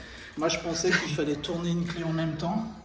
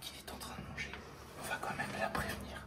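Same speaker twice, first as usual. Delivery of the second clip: library whisper; 14 dB quieter than usual. Real, not acted. Chuchote, voisine qui mange.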